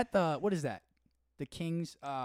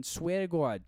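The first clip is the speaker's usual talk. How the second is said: higher Boston oath; Boston accent; 4 semitones higher, slower than usual. Acted. Showing a cohost how Boston it is, Boston accent. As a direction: savor the Boston vowels. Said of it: "that's so Boston".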